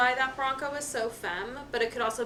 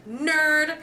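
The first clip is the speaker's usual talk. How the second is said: mock kids' taunt; 6 semitones higher, 8 dB louder than usual. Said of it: "the kids went".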